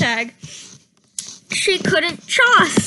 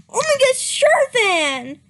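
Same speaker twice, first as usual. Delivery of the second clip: happy yell; 8 semitones higher, 3 dB louder than usual. Acted. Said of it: "Starpaw yelled happily".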